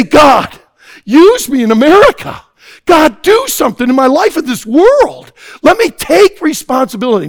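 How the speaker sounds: loud, high, fervent prayer cry; shouted prayer. Acted. Preacher voicing the youths' prayer, shouting fervently. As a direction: call out to God at full force.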